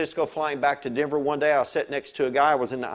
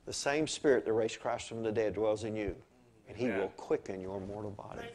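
softer, lower hushed scripture; softer solemn quote; 5 semitones lower, 9 dB quieter than usual. Retold or imitated. Pastor quoting the verse in a soft hush. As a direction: let the verse land quietly. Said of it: "he said this".

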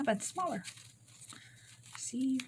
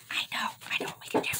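fast, excited whisper; faster than usual. Real, not acted. Crafter sharing an idea after shouting, quick whisper. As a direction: whisper it in a rush.

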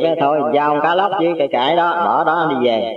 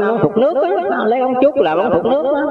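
pitched way up, insistent explaining; high explaining voice; 8 semitones higher, faster than usual. Retold or imitated. Old master explaining the bamboo pipe to the neighbour, in a high insistent voice. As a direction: raise the voice high to spell it out.

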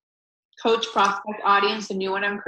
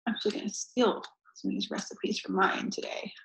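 conspiratorial whisper; 7 dB quieter than usual. Real, not acted. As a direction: whisper it like a secret. Confessing aside.

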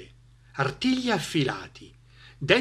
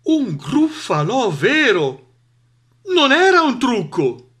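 high, drawn-out cry, getting louder; horrified discovery; 10 semitones higher, 6 dB louder than usual. Acted. Fox sees the monster is real, horrified. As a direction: stretch out the dawning horror.

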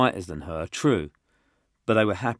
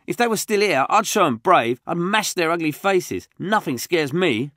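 put-on little tough kid; 11 semitones higher, 9 dB louder than usual. Josh boasting to the group, high and loud. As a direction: brag like a scrappy kid. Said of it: "said Josh".

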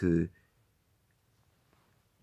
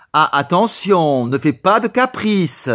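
louder, slow stern warning; 7 semitones higher, 6 dB louder than usual. Acted. Teacher warning the boy against tantrums, loud and stern. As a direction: scold a sulking child firmly.

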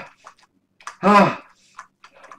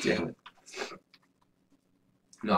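ordinary talk, nothing marked

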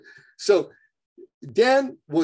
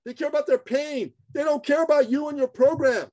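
much higher, forceful, near-shouting tirade; fired-up rant; 6 semitones higher than usual. Real, not acted. Coach tells listeners to stop pushing their program, heated near-shout. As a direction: hammer the point home like a fed-up coach. Rant against self-focused selling.

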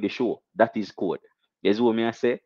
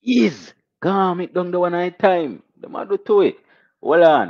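much higher and louder, slow hype-man call; hype shout; 9 semitones higher, 5 dB louder than usual. Real, not acted. Streamer shouting to his live chat, loud excited hype. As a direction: bellow it out like a dancehall MC. Hype intro after the sound effect.

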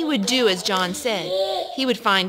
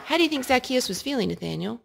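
usual speaking voice

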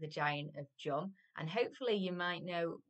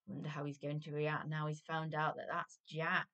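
plain reading voice

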